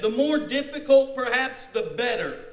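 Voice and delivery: high, loud, keen declaration; eager boast. Acted. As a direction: relish the challenge with gusto. Voices Naaman's eager boast.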